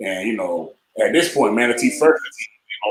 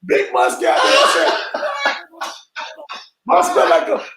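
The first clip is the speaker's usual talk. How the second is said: hammed-up shouted heckle from the sideline; mock heckling shout; 13 semitones higher than usual. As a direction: yell it like teammates clowning him.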